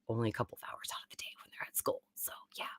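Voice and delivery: fast unvoiced aside; whisper. Real, not acted. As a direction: whisper it like a secret. Suddenly drops to whisper.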